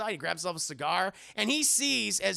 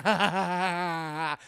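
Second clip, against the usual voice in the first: madman's laugh; 5 dB louder than usual. Retold or imitated. Renfield scares the nurse, hammed-up mad laugh. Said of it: "you see a guy going".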